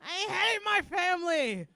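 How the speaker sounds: high, loud, slow yell; exasperated outburst. Acted. Comic fed up with family, hammed-up slow yell. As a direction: wail it loudly, drawn out.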